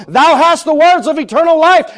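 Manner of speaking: preacher proclaims Peter's answer to the congregation, full force; full-force preaching. Real, not acted. Proclaim it high and emphatically.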